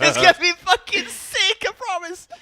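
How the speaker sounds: loud, high, excited yell; hyped shout. Real, not acted. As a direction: hype up the play, shouting. Hyped calling out a play.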